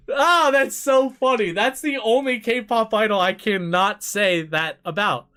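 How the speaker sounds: loud, high, delighted exclamation; amazed and cracking up; real amused outburst. Real, not acted. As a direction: burst out at a hilarious realization. Cracks up at realizing his mistake.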